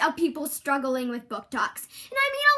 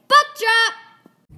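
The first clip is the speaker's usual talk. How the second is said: shouted catchphrase; 7 semitones higher, 6 dB louder than usual. Acted. Commercial catchphrase yelled out.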